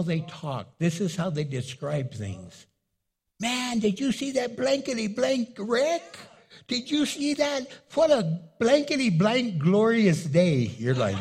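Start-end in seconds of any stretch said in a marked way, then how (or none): 3.4-10.7 lightly voiced excited guy telling about a wreck; mock a careless talker; casual cusser.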